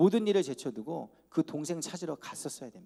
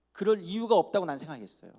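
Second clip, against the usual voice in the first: ordinary delivery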